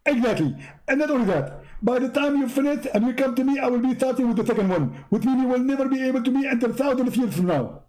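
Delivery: high, brushed-off mock voice; mocking Zakir Naik voice. Preacher to visitor at the door, mock high voice. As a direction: play a busy preacher waving him away.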